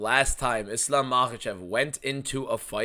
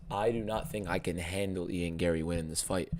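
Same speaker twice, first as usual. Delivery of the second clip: ordinary delivery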